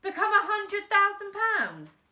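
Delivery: put-on outraged boy's question; boy protesting loudly to crime boss; indignant protest. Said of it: "protested Frank".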